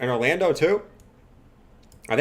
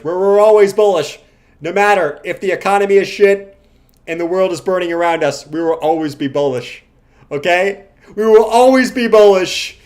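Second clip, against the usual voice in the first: mock cult sermon; 3 semitones higher, 7 dB louder than usual.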